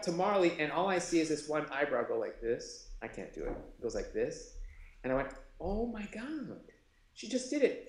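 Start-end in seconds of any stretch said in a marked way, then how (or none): 5.6-7.8 amazed realisation. Retold or imitated. Sudden realisation retold.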